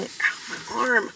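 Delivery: real arm pain. Real, not acted. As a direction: wince at a sore arm. Sore arm, morning vlog.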